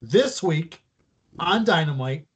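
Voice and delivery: high, slow build with long pauses; dramatic announcement. Pretend. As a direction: announce it like a big reveal, pausing between phrases. Teasing a big announcement with dramatic pauses.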